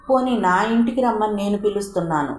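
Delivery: ordinary delivery, nothing marked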